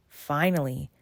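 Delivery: plain reading voice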